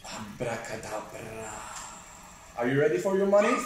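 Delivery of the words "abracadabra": magic spell. Acted.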